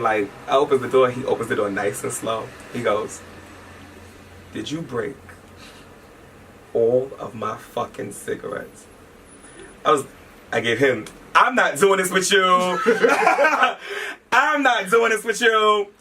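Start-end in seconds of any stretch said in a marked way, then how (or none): 4.5-8.9 calm imitation. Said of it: "he's so calm about it". 11.3-15.9 high-pitched, laughing retold protest; mock protest. Playfully acts out the fight.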